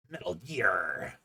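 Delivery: very low, slow gravelly game-hero growl; low slow Metal Gear hero impression. Shown as a demonstration. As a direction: drop deep and drag it out like the soldier. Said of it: "haven't heard any of my impressions of characters".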